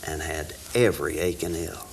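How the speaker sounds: reciting a rhyming poem with rising energy; poem recitation. Acted. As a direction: build each line like a campfire recital.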